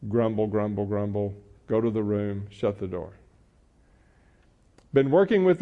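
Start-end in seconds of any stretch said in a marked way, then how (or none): 0.1-3.1 low, quiet grumbling; grumbling mutter. Retold or imitated. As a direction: grumble it under your breath. Teen stomping off muttering.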